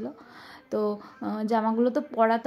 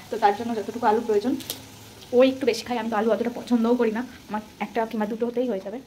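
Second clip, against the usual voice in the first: normal talking voice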